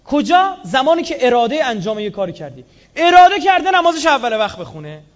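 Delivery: loud, high, forceful sermon voice; full-force preaching; fiery preaching. Acted. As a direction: shout it to the whole congregation.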